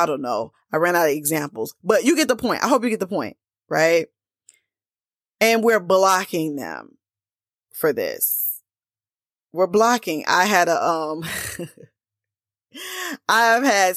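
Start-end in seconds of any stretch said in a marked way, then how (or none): none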